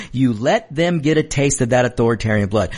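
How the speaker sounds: ordinary delivery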